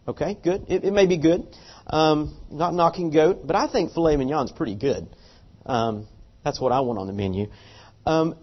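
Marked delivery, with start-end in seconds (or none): none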